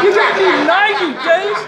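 high-pitched mock outrage; incredulous. Defendant to judge on hearing his sentence, incredulous. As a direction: protest in comic disbelief.

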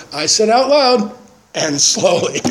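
high, loud, slow on out loud; stern correction. Retold or imitated. Sponsor correcting reading in restaurant, stern slow emphasis. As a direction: bark it like a strict teacher. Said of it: "he'd go".

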